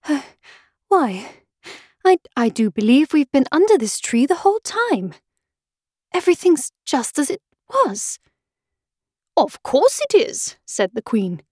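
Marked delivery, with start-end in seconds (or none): 0.0-8.2 breathless wonder, rising in pitch; puzzled amazement between breaths; great surprise. A narrator's reading. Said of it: "in great surprise". 9.4-10.6 high, matter-of-course reply; brisk royal retort. A narrator's reading. Queen answers Alice's surprise.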